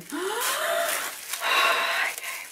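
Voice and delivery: long rising gasp of delight; excited gasp. Real, not acted. Unboxing a present from a friend, a rising delighted gasp. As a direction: suck in air, thrilled. Opening a gift box.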